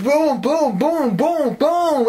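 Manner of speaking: very high, swooping vocal explosions; imitated booms. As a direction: fire off high-pitched booms.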